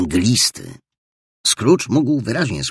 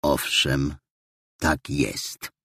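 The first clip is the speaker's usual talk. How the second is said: put-on lower, softer, grudging old miser; gruff old Scrooge; 5 semitones lower, 5 dB quieter, much slower than usual. Scrooge dismisses his nephew, low and grudging. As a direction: growl it out like a sour old man. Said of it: "rzekł Scrooge".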